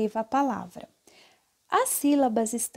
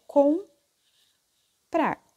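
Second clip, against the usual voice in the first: "comprar" slowly split as com, pause, prar; sílabas separadas; 4 semitones higher, 5 dB louder than usual. Shown as a demonstration. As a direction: leave a long gap inside "comprar" after com. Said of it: "eles se separam". ("comprar" bem devagar, separado: com... prar)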